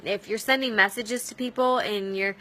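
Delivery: ordinary talk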